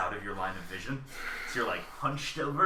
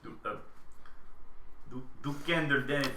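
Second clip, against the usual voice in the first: mock Danish; 3 semitones higher, 5 dB quieter than usual. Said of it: "says a thing in Denmark".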